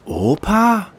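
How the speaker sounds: high sharp surprised question; shocked. Acted. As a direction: gasp it out in shock. Paul shocked.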